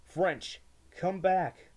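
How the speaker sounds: shouted from far away; distant call. A narrator's reading. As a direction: call out loudly across a distance. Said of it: "I heard Miguel once call".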